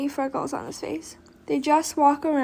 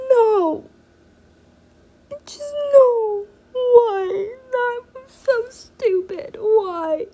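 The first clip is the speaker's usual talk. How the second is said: high, teary, louder whine; real crying protest; 9 semitones higher, 5 dB louder, much slower than usual. Real, not acted. Upset at the story's ending, crying protest. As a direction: whine through real tears, much higher.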